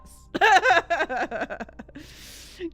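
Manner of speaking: loud high burst, tapering off; long real cackle; real laugh. Real, not acted. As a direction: burst out laughing and let it fade. Laughs after skipping time.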